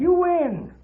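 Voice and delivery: high, strained surrender; giving in. Acted. Old outlaw finally gives in to Paladin, high and strained. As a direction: give up with a pained, high voice.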